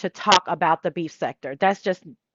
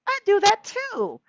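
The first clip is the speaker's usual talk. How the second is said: much higher put-on voice; imitating myself; 12 semitones higher than usual. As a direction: squeak it out playfully. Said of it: "And I'm like".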